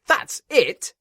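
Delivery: spluttering. A narrator's reading. Inspector outraged at the controller, high loud splutter. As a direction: sputter that's it out indignantly, high and loud. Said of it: "spluttered the inspector".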